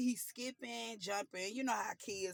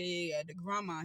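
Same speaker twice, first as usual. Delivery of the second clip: ordinary talk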